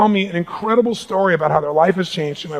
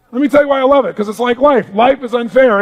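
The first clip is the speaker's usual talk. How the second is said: ordinary delivery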